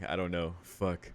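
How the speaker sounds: ordinary delivery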